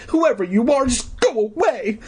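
high frantic shout; frantic scream. Acted. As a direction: scream at the thing to leave. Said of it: "I screamed frantically".

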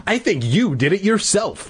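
high-pitched, mild sneer. A narrator's reading. Sister blaming her brother, sneering. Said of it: "suggested with a sneer".

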